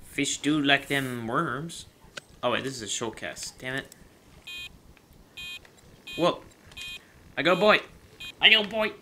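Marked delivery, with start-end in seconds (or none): 6.2-8.9 high excited yells; excited shouting. Real, not acted. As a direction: shout it with glee. Excited shout at fish catch.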